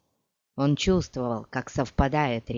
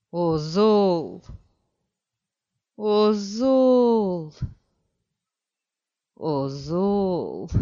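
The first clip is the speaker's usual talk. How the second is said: drawn-out mournful repeated name; grieving call; 3 semitones higher, much slower than usual. Acted. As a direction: lament the blinded friend. (скорбный зов)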